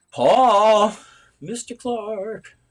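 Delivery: high, drawn-out call; loud "Paul", then a long, high "Mister Clark". Real, not acted. Calling for invisible Paul to answer, high drawn-out call.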